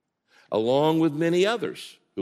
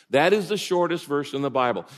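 usual speaking voice

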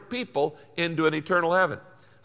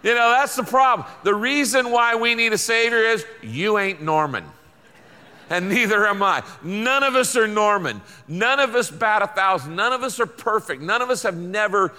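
fired-up sermon voice; forceful preaching; 4 semitones higher than usual. Acted. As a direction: drive the point home to the congregation.

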